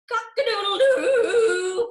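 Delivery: slow, loud crowing shout; rooster crow. Acted. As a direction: throw back your head and crow. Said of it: "crowed the rooster".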